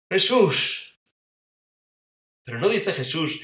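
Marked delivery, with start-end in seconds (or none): 0.1-1.0 a sudden, loud, higher cry; overjoyed recognition, called out; joyful cry of recognition. Acted. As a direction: cry out his name in sudden joy. Mary suddenly recognizes Jesus.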